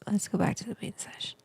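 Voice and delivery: whispered aside; real whisper. Real, not acted. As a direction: say it under your breath, off-mic. Aside while managing stream.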